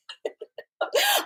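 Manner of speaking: helpless high giggle fit; high burst of laughter. Real, not acted. High giggle fit at the guide's cheek. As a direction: break into a long high laugh. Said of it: "I'm sorry I'm laughing so much".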